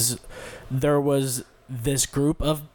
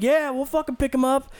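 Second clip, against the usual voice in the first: higher, eager crowd voice; excited crowd; 10 semitones higher than usual. Retold or imitated. Crowd agreeing to lift the kid, high eager voice. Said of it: "everybody's like".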